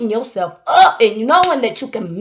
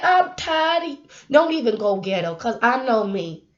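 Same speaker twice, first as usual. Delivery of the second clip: normal talking voice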